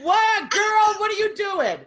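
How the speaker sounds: high, loud playful disbelief; mock astonished shout. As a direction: shout like a stunned girlfriend. Acts out astonished viewers' reaction.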